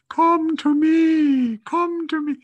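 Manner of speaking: high, loud, drawn-out beckoning on one held note; long, piercing siren-like summons; refrigerator's megaphone call. Acted. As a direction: call out like a tempting fridge with a megaphone. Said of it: "the refrigerator has a little megaphone".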